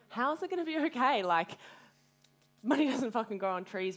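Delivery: high, loud, desperate cry of how; retelling her panic, voice jumping up loud; mother and daughter, she cries out in panic, loud and high; panicked outburst. Retold or imitated. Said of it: "and I was crying".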